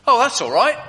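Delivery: high, loud and bright; Paul gladly waves off the guard's worry; cheerful Paul. Acted. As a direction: brush it off brightly. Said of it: "Paul says".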